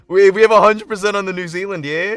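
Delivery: loud, high New Zealand announcement easing down; New Zealand accent. Shown as a demonstration. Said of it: "New Zealander?".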